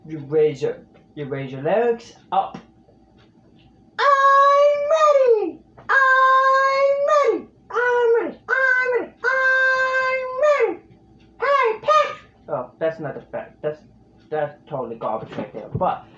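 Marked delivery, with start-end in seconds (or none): 4.0-10.8 very high pinched cartoon squeal; SpongeBob voice. Shown as a demonstration. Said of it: "he is a high-pitched character, so you're raising that larynx up". 11.4-12.2 very high squeezed cartoon voice; SpongeBob voice. Shown as a demonstration. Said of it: "he is a high-pitched character".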